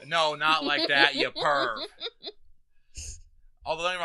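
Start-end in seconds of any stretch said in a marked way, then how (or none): 0.1-1.8 laughing. Real, not acted. Laughing denial to friend.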